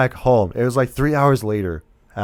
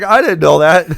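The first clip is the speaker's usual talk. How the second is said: loud, raised-pitch mock protest; indignant outburst; 7 semitones higher, 9 dB louder than usual. Co-host echoing the husband who just learned of it, indignant outburst. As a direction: echo a shocked husband's yell.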